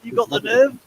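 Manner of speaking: mock indignation. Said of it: "It's like".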